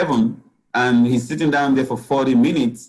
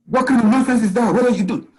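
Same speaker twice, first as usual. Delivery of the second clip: higher-pitched exasperated dad scolding his daughter; imitating scolding father; 9 semitones higher, faster than usual. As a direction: mimic a father's high, impatient voice. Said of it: "because his voice is like".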